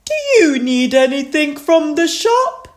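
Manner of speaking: high, loud storybook figure voice; asking round. A narrator's reading. Asking each house from the boat, high and loud. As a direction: call the question from a boat. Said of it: "asking if people needed anything".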